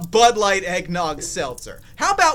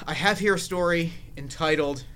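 normal talking voice

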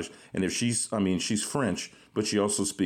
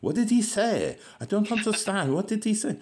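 French sister-in-law confused; 13 semitones higher than usual. Retold or imitated. Sister-in-law can't understand the Cajun guy, high and baffled. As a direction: squeak it out, lost and baffled. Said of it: "And she'd".

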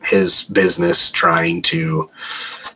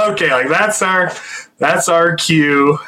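nervous resignation; 8 semitones higher than usual. Retold or imitated. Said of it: "we're like".

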